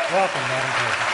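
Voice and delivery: warm, loud announcement of the guest; loud welcome. Real, not acted. Host welcoming the president to the stage, loud. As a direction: call her up to the stage with a big welcome.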